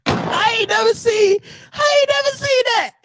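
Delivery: very high squeal through laughter; high-pitched, cracking-up amazement; helpless disbelief. Real, not acted. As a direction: squeal it, barely holding the laugh.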